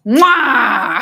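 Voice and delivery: big, high "mwah" kiss; loud blown kiss. Real, not acted. Streamer signing off to viewers, loud blown kiss. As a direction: send out a loud, drawn-out smooch.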